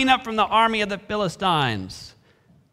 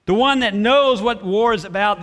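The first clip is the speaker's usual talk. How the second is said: ordinary talk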